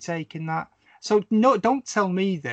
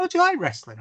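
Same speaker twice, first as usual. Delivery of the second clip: imitating her, pitched much higher; a light high-voiced quote of a friend, eagerly asking; bright surprised question; 13 semitones higher, faster than usual. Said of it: "She went".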